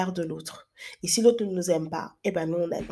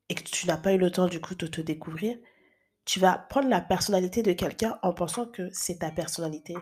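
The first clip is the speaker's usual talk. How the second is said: ordinary talk, nothing marked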